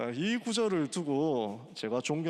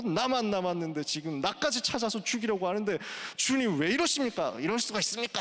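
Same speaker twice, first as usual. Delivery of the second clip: raised, mock-indignant whine; sulky protest; 6 semitones higher than usual. Elijah asking the Lord why he does this, in a sulky protest. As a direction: imitate someone in a huff, pitched up. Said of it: "막 삐져가지고 이러는 겁니다".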